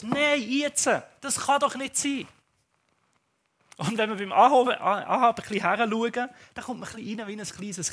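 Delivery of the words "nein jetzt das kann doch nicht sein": put-on higher, louder whiny child; toddler tantrum. Pastor imitating his small daughter's frustration. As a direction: imitate a frustrated little girl.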